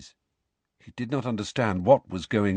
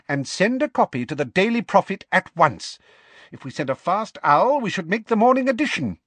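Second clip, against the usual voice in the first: high, excited instructions; gleeful; 10 semitones higher, 4 dB louder than usual. A narrator's reading. Minister ordering notes sent to the newspaper, gleeful. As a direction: give orders with rising glee. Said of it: "now radiant with glee".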